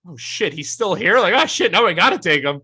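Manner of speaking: loud, high-pitched imitation of stunned disbelief; hammed-up, high and loud panicked surprise; mock dismay. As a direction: act out a team's loud "oh no" shock. Imitating team stunned at the pick.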